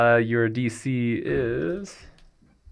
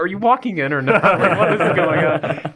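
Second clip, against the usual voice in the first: higher, amused impatient nagging; impatient character; 7 semitones higher, 4 dB louder than usual. Acted. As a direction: demand an answer, half laughing. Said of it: "Grayson's like".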